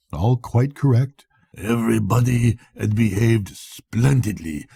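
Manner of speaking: ordinary delivery, nothing marked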